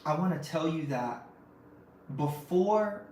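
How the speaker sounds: normal talking voice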